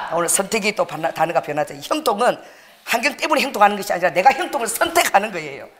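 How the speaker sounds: ordinary talk, nothing marked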